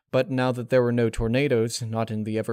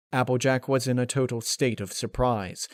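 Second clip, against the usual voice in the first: usual speaking voice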